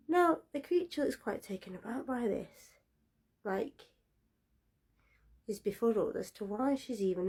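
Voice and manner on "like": ordinary delivery, nothing marked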